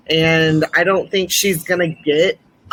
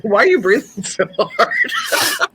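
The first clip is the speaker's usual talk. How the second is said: laughing question; 5 semitones higher than usual. Real, not acted. Teasing co-host over loud breathing.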